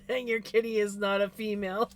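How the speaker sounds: words broken by laughter; laughing. Real, not acted. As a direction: giggle through the line. Laughing through the punchline.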